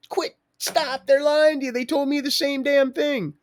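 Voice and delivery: high, loud pleading shout; retold high yell. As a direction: cry out to him that they're lying. Yelling at police about lies.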